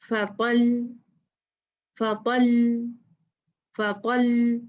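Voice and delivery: फ़क़ल stopped on a light, thin ल; light lam stop. Shown as a demonstration. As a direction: say फ़क़ल and hold on the final ल without thickening it. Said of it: "इसको कैसे पढ़ेंगे?".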